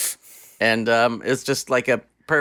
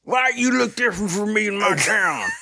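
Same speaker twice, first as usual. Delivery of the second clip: a higher, put-on cowboy warning off a stranger; hostile cowboy; 9 semitones higher than usual. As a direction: play a gruff small-town cowboy chasing someone off. Hostile cowboy voiced in bit.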